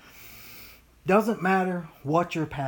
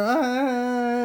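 mock child's wail, drawn out and higher; fake crying; 6 semitones higher, 3 dB louder than usual. Preacher imitating kids crying over nothing, fake wail. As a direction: imitate a kid's put-on crying with one long "ah". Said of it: "a kid is fake crying".

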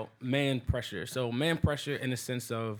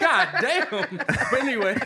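laughing; 10 semitones higher, 8 dB louder, slower than usual. Real, not acted. Still laughing, tries to resume.

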